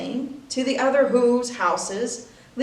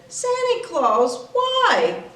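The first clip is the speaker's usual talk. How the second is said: put-on high, small child's pleading voice; a tiny girl asking why, voice up high; little girl voice; 6 semitones higher than usual. Cindy Lou Who pleads with the fake Santa, in a high little voice. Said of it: "She stared at the Grinch and said".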